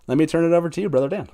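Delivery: normal talking voice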